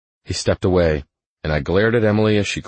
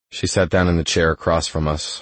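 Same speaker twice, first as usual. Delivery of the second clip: plain reading voice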